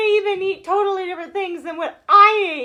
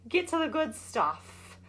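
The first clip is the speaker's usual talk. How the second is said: ordinary delivery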